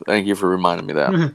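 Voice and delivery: normal talking voice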